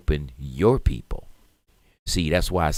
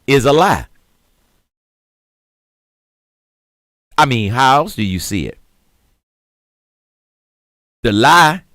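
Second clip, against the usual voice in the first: louder, higher fired-up rant; heated rant; 9 semitones higher, 10 dB louder than usual. Real, not acted. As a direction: preach your outrage to the viewers. Building political rant.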